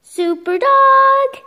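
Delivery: very high, loud, slow call; heroic call. Acted. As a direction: call out the hero's name triumphantly. Hero called in comic.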